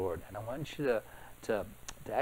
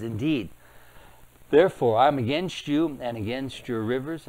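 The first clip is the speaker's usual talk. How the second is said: louder preaching voice, declaring God's words; loud scripture proclamation; 6 dB louder than usual. Acted. Preacher reading God's judgment on Egypt, loud and proclaiming. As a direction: thunder it out like a prophet.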